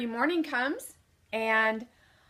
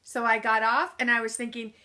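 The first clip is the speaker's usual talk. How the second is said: ordinary talk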